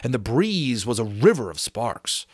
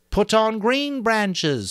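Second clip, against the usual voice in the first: Maurice voice; 12 semitones higher, 4 dB louder, much slower than usual. A narrator's reading.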